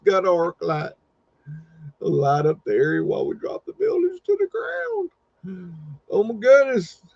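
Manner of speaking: high-pitched, hammed-up yokel wrecker; brash country demolition worker. Demolition worker warns onlookers off, hammed-up holler. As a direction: holler warnings like a cartoon demolition hand.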